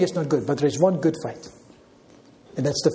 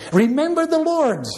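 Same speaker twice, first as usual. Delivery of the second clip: high ringing rallying cry; preacher calling the flock to battle; 10 semitones higher than usual. Acted. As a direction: shout it like a war cry for the faithful. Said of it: "That ought to be your battle cry".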